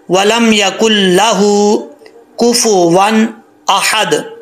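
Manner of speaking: chanted slowly; slow recitation. Shown as a demonstration. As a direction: read slowly, joining काफ to लाम. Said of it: "मिला के पढ़ना है".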